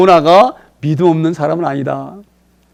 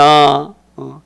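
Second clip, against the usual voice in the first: ordinary delivery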